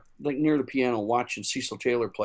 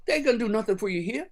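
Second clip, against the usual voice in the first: higher, quick lightly voiced jazzman; 7 semitones higher, faster than usual. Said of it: "He goes".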